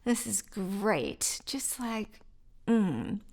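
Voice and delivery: slow, soft, contented sigh on the floor; relaxed sigh. Retold or imitated. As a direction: melt into it, slowly and quietly. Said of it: "chill and be like".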